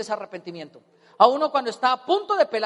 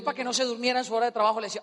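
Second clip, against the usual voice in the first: ordinary talk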